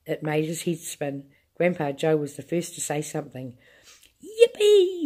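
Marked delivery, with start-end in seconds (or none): 4.2-5.0 high, loud whoop of joy; joyful yell. A narrator's reading. Grandpa leaping to grab Charlie's hand, joyful loud yell. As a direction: whoop it out with glee. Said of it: "he yelled out".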